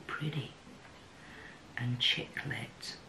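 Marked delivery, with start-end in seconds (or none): 1.8-3.0 hushed whisper. Real, not acted. Naming the shade in awe.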